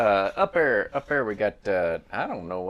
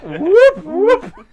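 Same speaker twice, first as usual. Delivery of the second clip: playful whoop; 18 dB louder than usual. Pretend. Playful sound while spinning torches.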